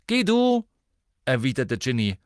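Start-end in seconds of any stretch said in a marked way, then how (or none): 0.1-0.7 high, loud order; curt command. A narrator's reading. Sister sends her boyfriend away, angrily. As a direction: send him off loudly.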